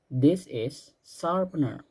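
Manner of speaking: slow and clear, word by word; slow model sentence. Shown as a demonstration. Teacher modeling a sentence for pupils to repeat, slow and clear. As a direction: spell it out slowly for the class to copy. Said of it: "Sekali lagi".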